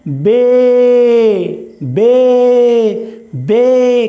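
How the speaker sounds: "बे" called out loud with a long ए; loud repeat-after-me. Shown as a demonstration. Teacher calling out "बे" loudly for pupils to repeat. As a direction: belt "बे" so the class echoes. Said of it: "बोलेंगे मेरे साथ".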